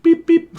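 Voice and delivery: very high electronic beeping; squeaky mimicked dial tone; phone beeps. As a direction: beep like a dead line. Imitates cut-off phone line.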